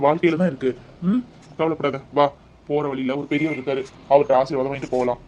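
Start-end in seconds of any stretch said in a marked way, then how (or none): none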